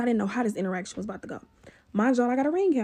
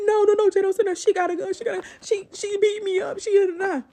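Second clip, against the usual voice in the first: crying plea; 8 semitones higher, 3 dB louder than usual. Retold or imitated. Neighbor crying to her friend.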